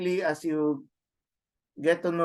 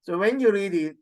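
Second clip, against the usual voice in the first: usual speaking voice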